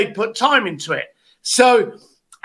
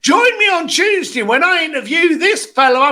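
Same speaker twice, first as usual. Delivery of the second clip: higher-pitched imitation of a guest's plug; mock promo poster; 8 semitones higher than usual. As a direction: lightly send up a podcast promo. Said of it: "little picture up in the corner going".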